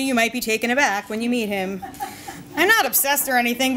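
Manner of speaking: usual speaking voice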